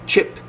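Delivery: "Chip" cut very short, relaxed vowel. Shown as a demonstration. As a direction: say "Chip" quickly with a loose vowel. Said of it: "the sound is very short".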